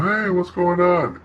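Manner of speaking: yacht crew arriving and greeting everyone, hammed up; mock party greeting. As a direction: ham up the newcomer's casual hello.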